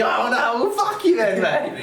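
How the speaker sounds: hammed-up angry snap at his mate; imitating angry outburst. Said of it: "He gets all angry at him".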